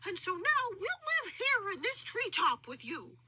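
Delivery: high, jittery monkey voice; scared monkeys telling their tale; frightened monkeys. A narrator's reading.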